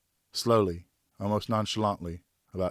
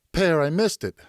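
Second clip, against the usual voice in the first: higher and louder, crestfallen cry; a boy sure he has failed; dismayed boy; 9 semitones higher, 6 dB louder than usual. A narrator's reading. Geoffroy crying out to Pear that he missed, higher and louder.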